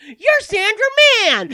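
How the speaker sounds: imitated starstruck yell, very high and loud. Wife imitating David spotting a local celebrity, loud high yell. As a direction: holler it out, mimicking a loud fan. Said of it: "David really loud yells".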